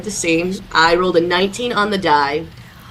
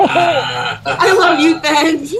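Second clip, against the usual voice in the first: laughing gush; 9 semitones higher, 4 dB louder, much slower than usual. Real, not acted. Player gushing again, laughing.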